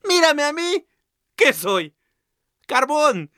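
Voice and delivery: a proud lump of coal calling out; coal character. Acted. El carbón habla, voz aguda. (el carbón presume con voz muy aguda)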